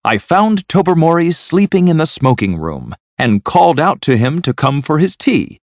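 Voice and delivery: high, excited account of amazing news. A narrator's reading. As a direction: tell it in high-pitched astonishment. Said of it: "looking very excited".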